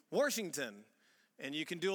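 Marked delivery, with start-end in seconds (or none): 0.1-0.8 Washington said as WORSH-ington; St. Louis pronunciation. Shown as a demonstration. Showing the audience the local way, St. Louis pronunciation. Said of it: "W O R S H".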